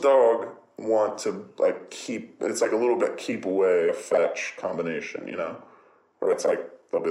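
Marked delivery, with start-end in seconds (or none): none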